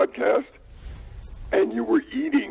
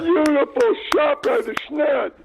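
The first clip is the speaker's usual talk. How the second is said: excited; 4 semitones higher, 3 dB louder than usual. Real, not acted. Cheering a golf shot on TV.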